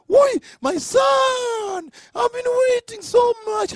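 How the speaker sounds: high, long-drawn wail of joy; acted overjoyed cry. Abraham hugging his long-awaited son, overjoyed cry.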